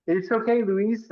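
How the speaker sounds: high-pitched mock comforting; patronizing reassurance. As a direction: imitate experts soothing with a pat on the back. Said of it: "a little, like, pat on the back".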